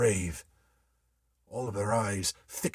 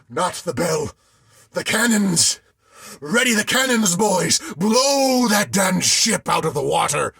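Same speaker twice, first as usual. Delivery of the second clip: high, loud desperate shout; crying out; 7 semitones higher, 8 dB louder than usual. A narrator's reading. Sailor begging the crew to fire the cannons, shouting. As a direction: yell for the guns. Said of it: "my own voice cry out".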